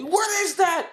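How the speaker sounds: mock clueless cashier. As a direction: play the cashier baffled and shrill.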